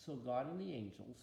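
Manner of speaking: plain reading voice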